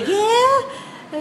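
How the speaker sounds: high called-out reply; a high sing-song answer from across the street; child answering grandmother. Retold or imitated. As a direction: kid calling back to a far-off grandmother.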